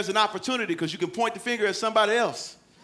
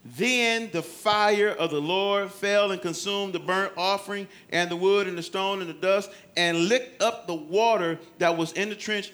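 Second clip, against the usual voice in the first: ordinary talk, nothing marked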